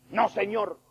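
high, loud cry of protest. Acted. Preacher voicing Peter's loud no. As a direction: Peter shouting no at his Lord.

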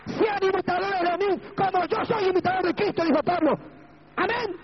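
high, sustained preacher's cry; impassioned preaching. Real, not acted. Preaches at full force. (predicación exaltada, en tono muy agudo)

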